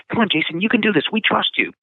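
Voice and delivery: rally him, sound sure of him; encouraging instructor. Retold or imitated.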